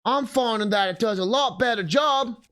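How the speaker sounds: hammy Aussie croc-hunter energy; Australian wildlife presenter. Pretend. Aussie wildlife-presenter bit with moblins.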